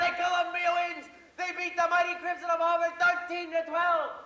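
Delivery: excited play-by-play, rising as the run goes on; sports announcer. Acted. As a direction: call the game like a radio sportscaster. Boy plays radio announcer alone.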